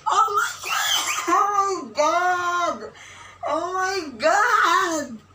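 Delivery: high, loud, drawn-out screams; fangirl screaming. Real, not acted. She wants to shout her loudest at the reveal, high, loud and drawn out. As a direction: scream with excitement. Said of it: "Gusto ko sumigaw ng pinakamalakas".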